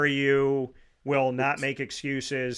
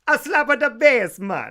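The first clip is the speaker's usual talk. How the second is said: much higher and louder, a goofy put-on voice; goofy Paul Rudd line; 10 semitones higher, 9 dB louder than usual. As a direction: belt out the silly movie line in a funny character voice. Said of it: "he goes".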